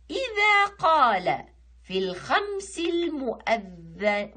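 slow, stretched verse reading; poem recitation. Shown as a demonstration. Teacher reciting the nashid for pupils, slow measured verse. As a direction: recite slowly with long held vowels.

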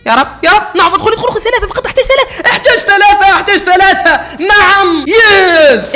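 high, loud begging for the last balls; frantic pleading. Real, not acted. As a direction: plead with the game then cheer. (توسل متحمس ثم فرحة)

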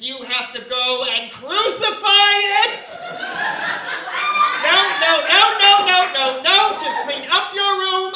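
loud, high, drawn-out exasperated parent; scolding parent. Acted. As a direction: build from stern question to shouted outburst. Acted scolding parent to child.

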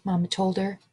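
ordinary delivery, nothing marked

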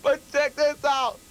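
usual speaking voice

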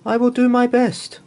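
high, loud eager reply; shy tailor answering the royals, pitched high and loud; eager promise. Acted. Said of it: "said Halibut Jackson".

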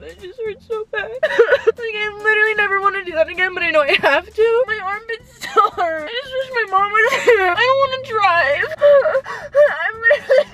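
high real sobbing, wailing between phrases; words breaking up into sobs; crying intensely. Real, not acted. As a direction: freak out in helpless tears. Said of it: "I am crying very intensely".

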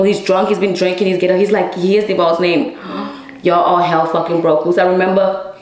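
normal talking voice

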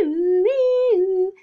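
high, sustained siren wail; loud siren imitation. Zoe being a fire engine siren, high loud wailing. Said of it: "a loud siren that goes".

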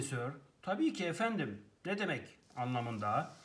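usual speaking voice

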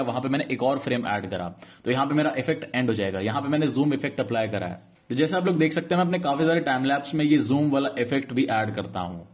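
a steady, polished sponsor read. Acted. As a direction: read the sponsor plug like a scripted ad.

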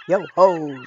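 loud gruff pirate call; pirate chief. Acted. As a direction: bellow it like a pirate captain. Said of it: "said the chief".

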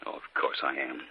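soft, hushed, soothing voice; husband quietly reassuring his wife; calm reassurance. Acted. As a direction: gently talk her down from fear. Husband calms frightened wife.

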